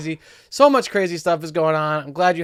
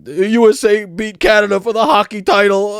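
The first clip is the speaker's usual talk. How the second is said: higher, louder, slow mock weeping; imitated choked-up dad; 5 semitones higher, 5 dB louder than usual. Dad choked up over the Olympics, imitated tearfulness. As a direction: drag it out as if overcome. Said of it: "He was choked up over".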